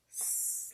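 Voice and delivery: "Slam," whispered and stretched; breathy smooth blend. Shown as a demonstration. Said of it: "try that again".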